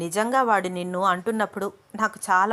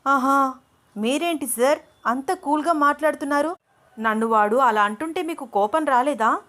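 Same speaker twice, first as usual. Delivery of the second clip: very angry; 6 semitones higher than usual. A narrator's reading. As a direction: flare up at him, pitch high. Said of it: "చాలా కోపంగా అంటోంది అను".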